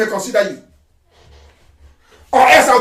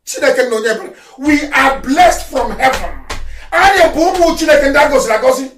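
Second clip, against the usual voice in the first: ordinary delivery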